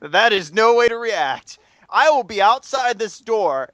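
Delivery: slow, measured, firmly calm; calm him down with slow words; very calm professor. Retold or imitated. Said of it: "very calm".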